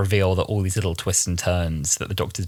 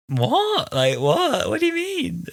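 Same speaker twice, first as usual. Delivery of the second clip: incredulous what; slower than usual. Real, not acted. Disbelief at the racist villain.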